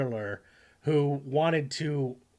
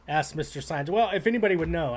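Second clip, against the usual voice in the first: ordinary talk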